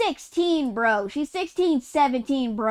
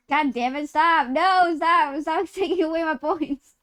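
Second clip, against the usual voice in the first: laughing protest; 4 semitones higher than usual. Real, not acted. Protesting lost points.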